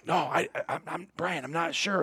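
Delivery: dithering in a whisper; hesitant murmur. Retold or imitated. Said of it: "Brad's like".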